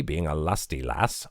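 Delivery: plain reading voice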